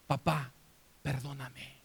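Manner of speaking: low, soft plea for forgiveness; subdued, sinking almost to nothing; hushed plea. Acted. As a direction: ask quietly, with a pause after the first word. Imagined plea to his dead father.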